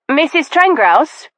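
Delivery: high call down the corridor. Acted. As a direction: call after her. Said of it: "I called".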